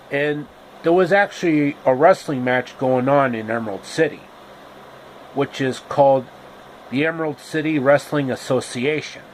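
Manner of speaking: usual speaking voice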